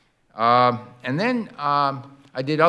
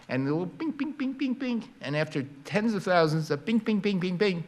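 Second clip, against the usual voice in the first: high, soft imitated pings; playful sound-effect pings, well above the voice; photons pinging; 7 semitones higher, 4 dB quieter than usual. Shown as a demonstration. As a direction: tap out tiny pings of light hitting the ball. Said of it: "the atom is this big bowling ball".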